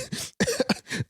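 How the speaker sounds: high unvoiced giggle; high breathy laugh. Real, not acted. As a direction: let out a high airy giggle.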